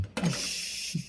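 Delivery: a very soft breathy embarrassed laugh; shy laugh. Acted. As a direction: laugh it off, a little sheepish. Son laughs shyly after explaining. (mahiyaing mahinang tawa)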